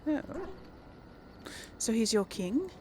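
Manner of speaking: ordinary talk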